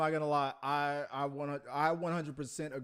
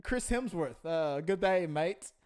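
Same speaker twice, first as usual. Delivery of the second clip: higher-pitched, badly faked Aussie accent drifting towards Irish; Chris Hemsworth; 4 semitones higher than usual. Co-host role-playing the Thor star praising his director, wobbly Aussie accent. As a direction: have a hesitant go at an Australian accent. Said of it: "No, like, now is that Irish?".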